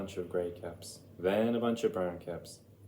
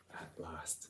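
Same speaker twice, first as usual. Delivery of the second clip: usual speaking voice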